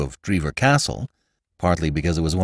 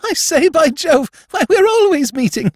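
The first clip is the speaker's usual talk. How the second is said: very high, louder toff voice; young lord calling; 5 dB louder than usual. Acted. Young lord calling out to Jimmy on the embankment, high louder toff voice. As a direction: hail him in a high excited aristocrat voice.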